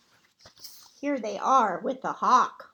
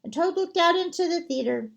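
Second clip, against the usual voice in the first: usual speaking voice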